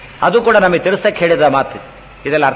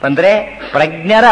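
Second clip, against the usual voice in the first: ordinary talk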